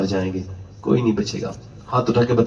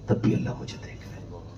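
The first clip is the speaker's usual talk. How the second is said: whispered, breathy with awe; hushed whisper of holy fear; awed whisper; 6 semitones lower, 8 dB quieter than usual. Retold or imitated. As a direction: breathe it out as if God is listening. Awed confession that God sees.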